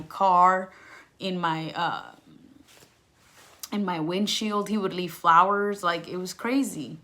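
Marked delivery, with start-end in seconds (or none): none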